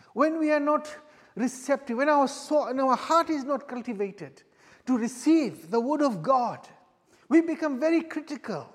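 fervent pulpit preaching. Acted. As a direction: drive the point home to the congregation. Rising sermon exhortation.

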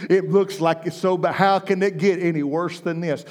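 ordinary talk

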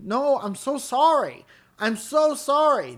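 high, loud drawn-out apology; mortified stranger lady. Retold or imitated. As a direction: imitate a woman wailing her apologies. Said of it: "you hear a stranger lady saying".

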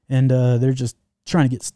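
ordinary talk, nothing marked

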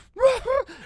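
high, loud imitated laugh burst; high mock laugh burst; burst of laughter. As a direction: blurt out a sudden laugh. Imitating friend's sudden laugh.

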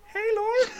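high, loud call of a worried bartender. Acted. A worried bartender calls out, in a high loud voice.

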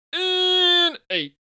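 very high, loud, drawn-out wail dropping on the number; over-the-top high announcer cry; game show announcer call. Acted. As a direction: belt out a darts game show prize number.

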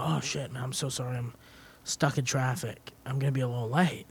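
soft, low mock-apologetic excuse; feigned apology. Employee calling his boss about being late, softly feigned apology. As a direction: play sorry, keeping it quiet and low.

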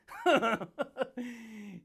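a long rolling laugh. Real, not acted. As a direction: laugh it out at your own joke. Long laugh after joke.

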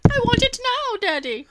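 put-on spoiled kid. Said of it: "spoiled child quote again".

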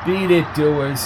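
ordinary delivery

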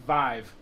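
"Vive" with a long i, like five; rhymes with five. Shown as a demonstration. Clearing up the name for co-hosts, long-i "Vive".

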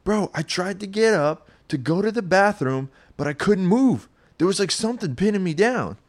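a scared buddy squeaking out an accusation; panicked friend. Retold or imitated. Friend on the couch accusing him, high and panicked. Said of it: "He's like".

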